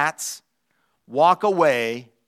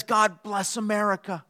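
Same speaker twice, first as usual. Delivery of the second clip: higher-pitched cat voice; lightly voiced cat, lifted and proud; a hint of pompous cat; self-centred cat; 4 semitones higher, 3 dB quieter than usual. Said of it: "A cat says".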